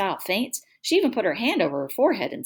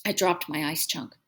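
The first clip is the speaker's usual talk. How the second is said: usual speaking voice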